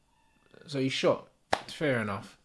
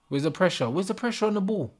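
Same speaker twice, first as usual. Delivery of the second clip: normal talking voice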